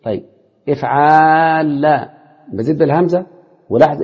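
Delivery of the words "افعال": افعال with a very long aa, then a double ل; long alif, doubled lam. Shown as a demonstration. Teacher showing a verb pattern, افعال stretched on aa into doubled ل. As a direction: hold the aa in افعال, land on doubled ل. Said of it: "الالف وبعد كده اللام المشددة".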